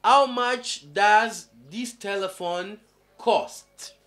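slow and clear, how stressed; teacher reading the English sentence slowly; slow model sentence. Shown as a demonstration. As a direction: spell the question out slowly for learners. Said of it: "combien coûte ce téléphone?".

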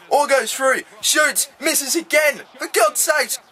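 high-pitched call of a missed chance; excited, then exasperated. Real, not acted. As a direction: build up the shot, then despair at the miss.

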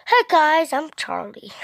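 high loud bright greeting; cheery host hello; loud vlog hello. Acted. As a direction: belt out the greeting. Vlog intro take.

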